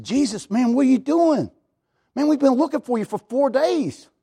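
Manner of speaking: mildly huffy mock parent, pitched high; high-pitched, hammed-up huffiness. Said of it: "they kinda get huffy at him".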